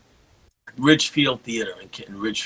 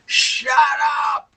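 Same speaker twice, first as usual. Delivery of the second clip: explosive shout, much higher; yelled; 4 dB louder than usual. Retold or imitated. Bandleader shouting down his singer. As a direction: bark it out like an angry bandleader. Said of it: "he yells at me".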